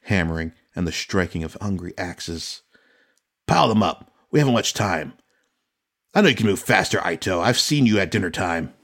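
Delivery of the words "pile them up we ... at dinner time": much louder, driving commands; shouted orders. Acted. Yoritomo urges the crew to build faster, shouting. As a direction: yell it over axes and hammering.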